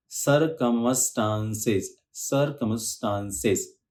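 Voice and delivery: सर्कमस्टांसेस slowly, each syllable clear. Shown as a demonstration. Teacher slowly sounding out an English word. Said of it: "हल्का सा बीच में pause लेना है".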